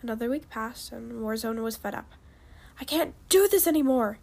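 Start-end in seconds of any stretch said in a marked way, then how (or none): 2.8-4.2 raised, louder outburst of frustration; fed-up shout. A narrator's reading. Warzone fed up after another week, shouted. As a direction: burst out, higher and louder. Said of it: "he shouted".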